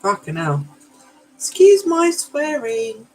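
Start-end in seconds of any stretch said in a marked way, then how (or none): 1.4-3.1 sung out high and slow, playful; a high, drawn-out apology; mock apology. Real, not acted. Apologising to viewers for swearing.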